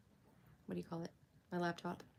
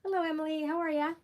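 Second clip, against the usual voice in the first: bright jump up high and louder; high-pitched hello; 9 semitones higher, 8 dB louder than usual. Real, not acted. Streamer greeting a viewer in the chat, high-pitched hello. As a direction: sing out a loud, high greeting. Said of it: "my voice goes up so high".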